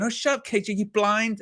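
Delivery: high, dismissive mimic of a mate; hammed-up irritated friend; mock Flex snapping. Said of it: "This is what you're going".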